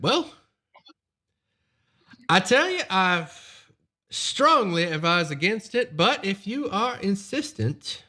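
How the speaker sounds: lightly voiced smooth noble; a hint of a polite nobleman; noble's voice.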